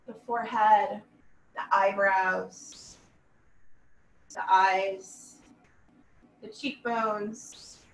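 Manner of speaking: slow, measured yoga nidra voice with long pauses; guided meditation. Acted. Yoga teacher leading students through a body scan, calm guided meditation. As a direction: guide them calmly, letting each body part settle.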